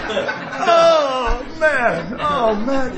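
loud, high hammed-up bawl; mock wailing. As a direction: wail it in comic overwhelmed anguish. Acts out his renewed crying.

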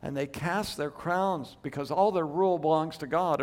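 usual speaking voice

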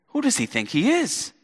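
high, scornful question; offended neighbour sneering at Jesus; indignant crowd. Retold or imitated. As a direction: let resentment rise in the asking. Crowd turns to resentment.

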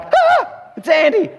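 high startled squeak then nervous name; scared yelp. Retold or imitated. Preacher spotting the giant neighbour, startled high yelp. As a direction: jump in fright at the sight.

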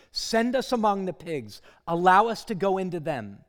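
loud anguished cry of the possessed man; loud desperate plea; demon's shout. Acted. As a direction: cry out loud at the top of your voice. Demons beg Jesus.

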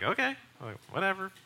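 a skeptical, noncommittal "whatever"; dismissive. Retold or imitated. Brushing off a stranger's odd claim, dismissive.

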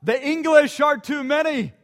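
high panicked cry; frightened soldier's cry. Acted. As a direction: cry it out, scared. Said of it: "One soldier cries out".

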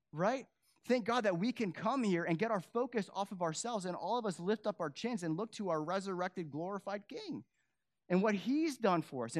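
fervent pulpit exhortation; impassioned preaching. Real, not acted. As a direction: lift them up with conviction. Preaching rises again.

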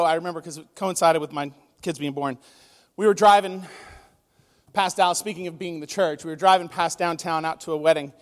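ordinary talk

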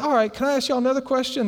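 high, quick, forward question; Skin. Retold or imitated. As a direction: push on brightly in a high voice. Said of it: "He said".

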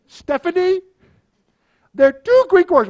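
very high, loud mock Greek accent; Spiros's Greek accent. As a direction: impersonate a Greek mentor proclaiming to the bride. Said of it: "he says, "Eric".

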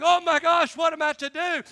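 loud, imitated panicking recruit; mock panic. Overwhelmed recruit fretting to himself, mock panic. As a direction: play him losing his mind. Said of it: "Hicks was losing his mind".

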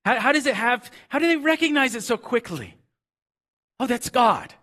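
high, loud, wondering rush of questions; a high, loud cry of recognition at "Oh, that's God"; amazed. Real, not acted. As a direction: pitch it up in amazement. Amazed question, voicing disciples.

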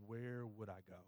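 low, soft question; humble confession. Acted. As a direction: settle quietly into the only answer. Same confession after wondering.

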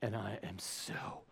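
very soft, slow whisper; mock intensity. Preacher mimicking someone relishing hardship, mock intense. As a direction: whisper it slowly, building suspense.

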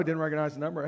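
ordinary delivery

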